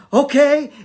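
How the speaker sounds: high, loud startled okay; stunned compliance. Retold or imitated. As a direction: blurt a dazed agreement. Retold dazed obedience after kiss.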